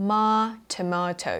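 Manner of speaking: 'tomato' with 'ma' as a long 'mah'; British tomato. Shown as a demonstration. Teacher isolating the British syllable, 'mah' in 'tomato'. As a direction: isolate 'ma', then 'tomato' as 'tuh-MAH-toh'. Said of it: "you say".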